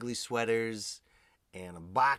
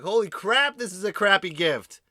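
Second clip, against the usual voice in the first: loud exclaim; 11 semitones higher, 14 dB louder than usual. Retold or imitated. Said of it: "I was like".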